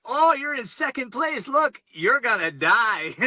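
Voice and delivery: a chirpy local voice cheerfully predicting doom; cheerful local woman. Retold or imitated. Aid station woman voiced.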